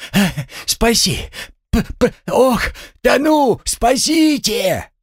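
very high, spluttering drowning cries; wailing for help. Acted. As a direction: splutter and shriek as if going under. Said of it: "и завопил по-другому". (истошный вопль тонущего, захлёбываясь)

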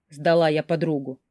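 ordinary delivery

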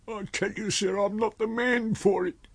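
high-pitched, protesting tramp voice; pleading tramp. Acted. Tramp telling his master he is unfit, high pleading. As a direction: beg off the job in a high character voice.

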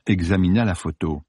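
ordinary delivery, nothing marked

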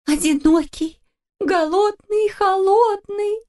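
high, slow, wavering "голодный и холодный" on the verge of crying; mama echoing the words, starting to cry; tearful. A narrator's reading. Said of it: "повторила мама мумитроля и расплакалась".

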